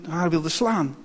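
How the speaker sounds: ordinary delivery, nothing marked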